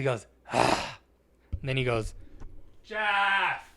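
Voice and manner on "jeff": high, drawn-out holler; mock call for the manager. Clerk summoning his manager, imitated holler. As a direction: imitate a lazy call across the store. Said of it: "he calls his manager like that".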